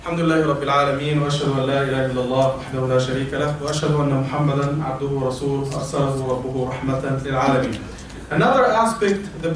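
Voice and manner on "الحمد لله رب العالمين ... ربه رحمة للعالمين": low, quick, level recitation; Arabic opening praise. Real, not acted. Preacher reopening the sermon, low rapid praise formula.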